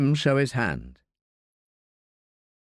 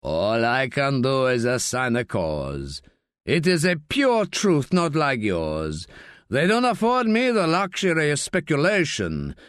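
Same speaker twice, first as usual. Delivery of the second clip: gruff coroner Piquet, defensive. Acted. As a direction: play an indignant Spanish coroner defending himself.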